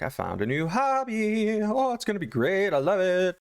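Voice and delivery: very high, gushing mock cheer; sing-song overexcited husband; super happy. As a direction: gush like a giddy new hobbyist. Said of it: "Jonas comes in super happy".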